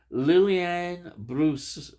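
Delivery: slow, drawn-out try at a French accent on the name; French accent attempt. Shown as a demonstration. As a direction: stretch the name out like a Frenchman would, a bit clumsily. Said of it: "I'm not very good at a French accent there".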